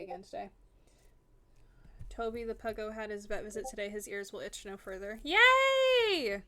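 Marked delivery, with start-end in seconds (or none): 5.2-6.4 high, loud squeal of a cheer; excited cheer. Real, not acted. Streamer cheering a dog's vet news, excited. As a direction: let out a soaring, drawn-out yay.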